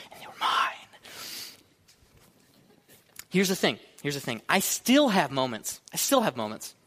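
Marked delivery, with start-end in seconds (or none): 0.1-0.9 possessive whisper. Acted. Treasured benefits, whispered.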